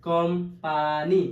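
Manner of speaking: কোম্পানি slow, split কোম, পা, নি; three separate beats on কোম্পানি, rising at the end; syllable by syllable. Shown as a demonstration. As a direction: spell out কোম্পানি by sound. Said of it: "ভাগ ভাগ করে উচ্চারণ".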